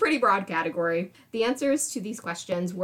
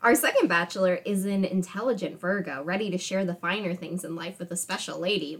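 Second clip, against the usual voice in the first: ordinary delivery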